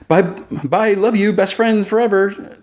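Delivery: high, breathless gush; Jimmy waving off his best friend, high and giddy; giddy goodbye. Retold or imitated. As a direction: float off, dazzled and giddy. Jimmy gushes goodbye to Clark.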